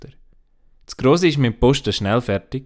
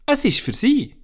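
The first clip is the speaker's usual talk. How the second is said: put-on a much higher child's voice; little girl Anna. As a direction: play the little girl handing over a gift. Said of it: "sagt Anna".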